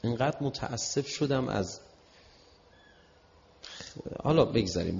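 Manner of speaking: ordinary talk, nothing marked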